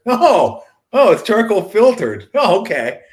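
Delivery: high, loud fake impressed oh; mock being won over by the label; sarcastic mock delight. Mocking the charcoal filtered label, in loud sarcastic delight. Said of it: "like that made it better".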